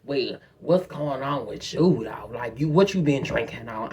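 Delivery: low, laid-back man's voice; flirting stranger. Retold or imitated. Man asking what she's been drinking, low imitation. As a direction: imitate him low and easy. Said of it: "he's like".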